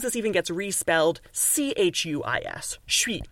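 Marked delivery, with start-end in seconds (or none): 2.9-3.2 "suis" said "shwee"; slurred "suis" like "chui"; casual French. Shown as a demonstration. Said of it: "that's what it sounds like".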